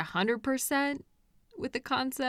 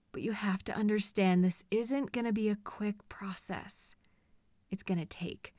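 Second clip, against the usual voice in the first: soft unvoiced whisper; 6 dB quieter than usual. Captor whispering a warning to the captive. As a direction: lean in and breathe it.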